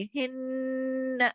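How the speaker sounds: slow हिन्न, softly held nasal hum; ghunna. Shown as a demonstration. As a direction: hold the n in हिन्न without lengthening the final vowel. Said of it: "गुन्ना करना है". (हिन्न में नून पर लंबा गुन्ना)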